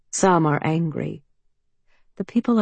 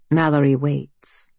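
ordinary delivery, nothing marked

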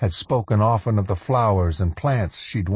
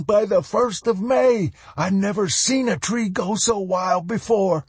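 put-on high-pitched, overjoyed old man; elated crowing; 14 semitones higher than usual. A narrator's reading. Sly Moody showing everyone the buds, elated. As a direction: crow with delight, voice pitched way up.

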